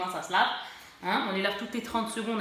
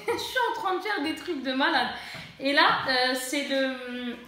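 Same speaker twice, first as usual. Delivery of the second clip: laughing; 3 semitones higher than usual. Real, not acted. Vlogger amused by her own messy makeup demo, laughing. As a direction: laugh through the words, pitch lifted.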